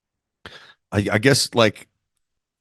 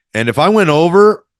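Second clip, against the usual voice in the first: emphatic excitement; 10 semitones higher, 8 dB louder than usual. Real, not acted. Emphatic retelling.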